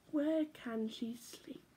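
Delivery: slow, hushed storybook whisper; whispered question. A narrator's reading. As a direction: whisper it like a sleepy secret. Tired cat looking for bed.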